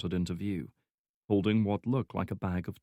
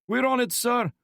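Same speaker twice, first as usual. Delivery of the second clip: put-on young agent voice; 5 dB louder than usual. Agent replies to Kamarov.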